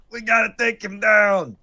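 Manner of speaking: high, loud rallying yell; hammed-up angry fan holler; mock mob scream. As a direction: shout like a pitchfork-waving mob. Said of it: "just screaming in the air".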